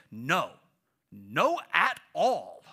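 put-on emphatic little kid refusal, drawn out; loud, slow NO AT ALL, like a stubborn toddler; Aiden. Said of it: "when Aiden wanted to use that phrase, he would say".

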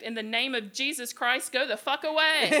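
building to a high, loud mock command; retold shout. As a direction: imitate driving something off, rising in force. Retold warning shouted after gunshot.

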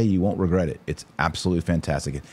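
ordinary talk

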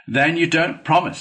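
demanding, affronted Badger; stern challenge. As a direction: confront him sharply. Badger confronts defiant Toad.

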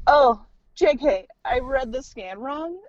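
high, drawn-out mock oops; loud lift, then slow admission; breezy retraction. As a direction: imitate a doctor airily taking it back. Doctor breezily retracting, mimicked.

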